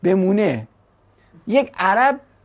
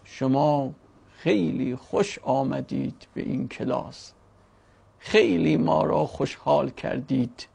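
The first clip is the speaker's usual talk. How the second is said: slow, lower, softer formal phrasing; slow bookish Arabic; 8 semitones lower, 7 dB quieter, slower than usual. Shown as a demonstration. Teacher demonstrating slow bookish speech for foreigners. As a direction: pronounce each word slowly like a textbook. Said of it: "میگه آقا یک نفر یواش صحبت کن، کتابی".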